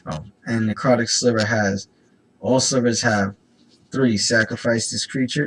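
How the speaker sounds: ordinary talk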